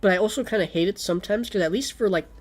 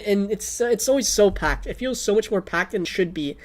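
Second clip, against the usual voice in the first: normal talking voice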